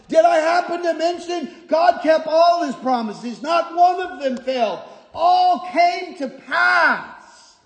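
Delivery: high-pitched, insistent preaching; emphatic hammering. Acted. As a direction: beat the point home, phrase after phrase. Preacher hammering the writer's repeated point.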